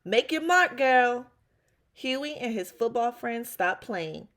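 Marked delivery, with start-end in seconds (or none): 0.0-1.3 loud high call from the truck. Acted. Uncle Red calling goodbye from his truck, loud and high. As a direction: shout it as he drives off. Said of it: "calling out".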